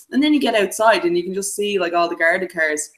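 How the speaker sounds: normal talking voice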